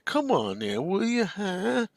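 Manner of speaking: a slow, complaining Archie Bunker whine; whining Archie voice. Retold or imitated. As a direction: needle her the way Archie would. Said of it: "do that whining Archie voice".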